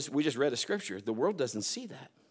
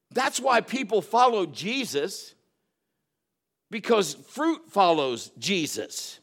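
loud, slow, drawn-out sermon cadence; fervent preaching; 6 semitones higher, 7 dB louder, slower than usual. Acted. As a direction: preach it slowly to the congregation. Preaching at full force.

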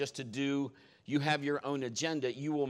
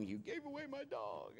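put-on high, softer whimpering kid voice; imitated crying child; 12 semitones higher, 11 dB quieter than usual. Son crying to his dad over the dog given away, high whimper. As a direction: whimper like a heartbroken little boy. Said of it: "He was crying".

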